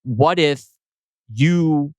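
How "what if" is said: normal talking voice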